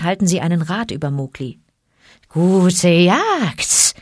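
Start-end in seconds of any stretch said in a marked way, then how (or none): 2.3-3.9 a touch of snake hiss, grim; grim hissing farewell. A narrator's reading. Snake Kaa grimly bids Bagheera good hunting. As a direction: flick the words out like a tongue. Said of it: "züngelte K grimmig".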